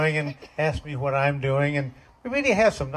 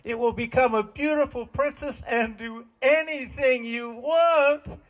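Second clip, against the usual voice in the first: higher, urgent buddy voice; excited Cap; 10 semitones higher than usual. Retold or imitated. Cap urges Elmer to kiss the frog, higher and urgent. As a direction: play Cap, eager and insistent. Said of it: "And Cap says".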